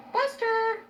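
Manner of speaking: high, loud call across the room; calling the dog. Real, not acted.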